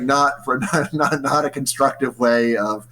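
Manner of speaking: ordinary talk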